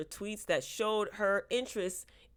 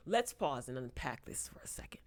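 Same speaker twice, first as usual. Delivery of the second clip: conspiratorial whisper to the viewers; whispered aside; 5 semitones lower, 7 dB quieter than usual. Real, not acted. Vlogger whispering an aside to her viewers. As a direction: lean in and whisper it.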